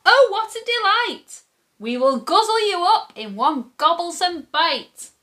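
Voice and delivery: high-pitched children mimicking the troll's relish. A narrator's reading. Children threaten to eat the troll, high delight. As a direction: pipe it up high, delighting in the feast. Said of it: "say the children".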